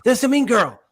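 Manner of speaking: high, loud imitation of public judgement; mock accusation. Host voices people typecasting the young actress, mock accusation.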